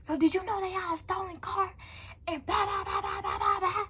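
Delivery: high, agitated grandma imitation; mock an accusing grandma in a high voice; confronting grandma. Grandma asking about the stolen car, confronting.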